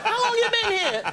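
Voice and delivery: high-pitched startled question. Retold or imitated. Comedian to the friend who sneaked up, high surprise. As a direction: blurt it out high, caught off guard. Acts himself startled by Michael.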